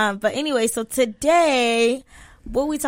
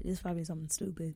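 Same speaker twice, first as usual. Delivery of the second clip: a real whispered aside; breathy secret aside, voiceless; 5 semitones lower, 18 dB quieter, faster than usual. Real, not acted. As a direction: whisper it under your breath. Aside to co-host.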